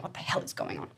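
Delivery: hushed, bewildered whisper; whispered animal confusion. Retold or imitated. Patient imitating a scared animal at the vet, whispered.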